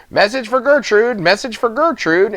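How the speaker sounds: high, urgent chant. A narrator's reading. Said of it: "in an urgent voice".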